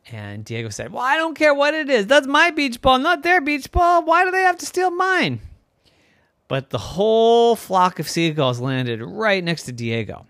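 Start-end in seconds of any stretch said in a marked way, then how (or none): 0.9-5.4 high, whiny outrage; indignant protest. Acted. Boy protesting the seagulls stole his ball, indignant whine. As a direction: insist it's yours, offended and pouting.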